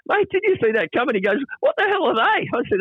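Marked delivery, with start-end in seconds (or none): 0.1-1.2 much higher, alarmed; startled. Retold or imitated. Near miss with a tram. 1.6-2.5 very high, astonished squeak; amazed Longy. Retold or imitated. Longy has never seen a tram.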